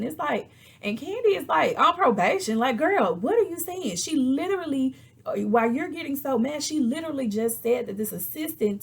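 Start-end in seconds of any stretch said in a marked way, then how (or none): 1.8-4.0 high, loud disbelief; incredulous retort. Retold or imitated. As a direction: throw it back high, can't believe it. Acts incredulous reaction to Drew.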